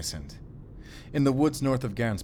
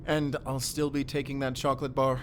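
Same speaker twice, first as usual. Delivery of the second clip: playful, a bit higher; cheeky; 5 semitones higher than usual. Acted. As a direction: tease while keeping the treat. Cheekily keeping chocolate.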